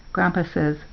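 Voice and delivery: ordinary delivery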